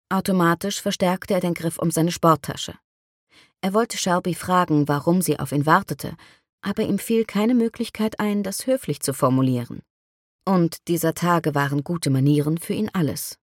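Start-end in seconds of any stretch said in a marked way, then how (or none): none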